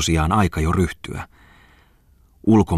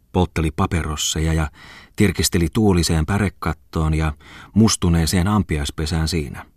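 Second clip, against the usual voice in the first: plain reading voice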